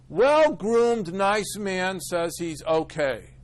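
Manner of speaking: high, loud and slow, reading out the doctor's note; mock chart reading. Speaker reading his psychiatrist's note aloud, mock-formal and slow. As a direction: spell out the chart entry like a verdict. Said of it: "What does she write down?".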